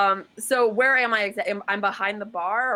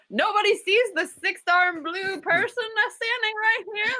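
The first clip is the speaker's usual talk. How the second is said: high, slow, drawn-out proclamation; announcing it aloud; 12 semitones higher than usual. Acted. Social worker imagines declaring the blue person aloud, high-pitched. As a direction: pitch it way up and stretch it out. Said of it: "I know if I go".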